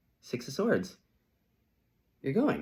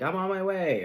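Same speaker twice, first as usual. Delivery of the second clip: mock breezy; 10 semitones higher, 7 dB louder than usual. Classic late friend.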